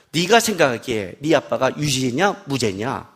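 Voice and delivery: low, slow, lightly voiced judge; judge questioning child. Judge asking a little child about dad, measured. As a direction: ask it slowly, pitched low.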